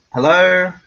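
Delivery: usual speaking voice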